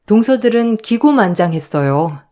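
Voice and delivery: plain reading voice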